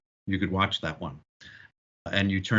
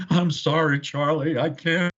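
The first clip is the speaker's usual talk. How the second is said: high, loud, mock cracking-up voice; pitched-up plea, as if overcome by giggles; imitating helpless laughter; 7 semitones higher, 4 dB louder than usual. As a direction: act out an actor losing it mid-take. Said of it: "he just starts laughing".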